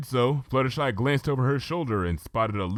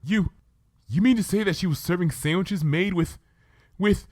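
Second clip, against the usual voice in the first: put-on shocked, halting young mare, a bit higher; stammering Twilight; 10 semitones higher than usual. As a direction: stumble over the words in disbelief. Twilight voiced in story.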